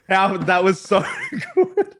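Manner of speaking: high, slow words through laughter; laughing. Real, not acted. Friend laughing about the news article, high slow giggling. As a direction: laugh while talking.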